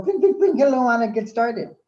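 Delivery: high chirpy machine greeting; computer voice. Retold or imitated. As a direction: imitate a cheery computer saying hello. Imitating computer startup greeting.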